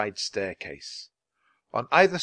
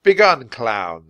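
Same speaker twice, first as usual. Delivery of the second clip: loud, slow, gruff dismissal; gruff guard; 10 dB louder, slower than usual. A narrator's reading. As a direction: send them off loudly and gruffly. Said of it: "the guard said gruffly".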